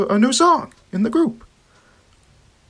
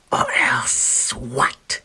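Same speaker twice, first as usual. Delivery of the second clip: very slow, whispered sneer; menacing whispered villain taunt; 10 semitones lower, 4 dB quieter, much slower than usual. Acted. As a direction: drag it out like a sly snake. Serpent sneers at Rocket.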